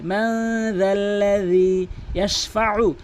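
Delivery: slow chanted recitation; Quran recitation. Shown as a demonstration. Teacher recites the corrected phrase, slow chant. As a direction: chant it slowly and evenly.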